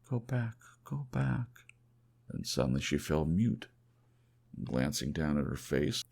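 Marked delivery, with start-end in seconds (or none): 0.1-1.7 a lightly played weeping beg; tearful plea. Weeping woman clinging to his arm, mild sobbing plea. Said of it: "saying between her sobs".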